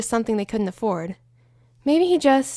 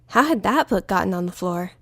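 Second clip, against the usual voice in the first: plain reading voice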